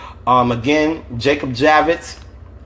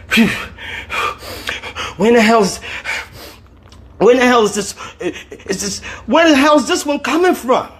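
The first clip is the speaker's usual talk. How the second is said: 1 pained bewildered Dwayne; 9 semitones higher than usual. A narrator's reading.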